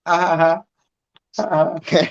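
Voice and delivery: put-on high, light childish laugh; child's giggle. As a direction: giggle like a little girl at nothing. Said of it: "she'll be looking in the corner and just be like".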